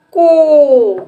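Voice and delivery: को said loud and long with round o; loud ko demo. Shown as a demonstration. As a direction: model को clearly for kids. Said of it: "क्या पढ़ेंगे?". (को को ज़ोर से साफ़ बोलना)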